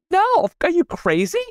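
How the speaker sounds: high-pitched exclamation of disbelief; mock incredulity. Answering someone who says nothing new can be invented, incredulous. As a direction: scoff at it, pitch jumping right up.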